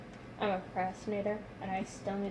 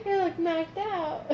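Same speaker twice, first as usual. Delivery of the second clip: tearful laughing; 7 semitones higher, 4 dB louder, slower than usual. Real, not acted. Laughing-crying retelling.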